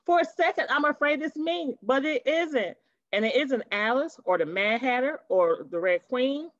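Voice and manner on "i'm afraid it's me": ordinary delivery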